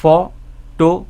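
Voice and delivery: "Photography" broken slowly into ফ, টো, গ্রা, ফি with pauses; syllable by syllable. Shown as a demonstration. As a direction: say "photography" one separated syllable at a time. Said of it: "এই যে আমরা জিভটা". ("Photography" প্রতিটি syllable আলাদা করে, থেমে থেমে)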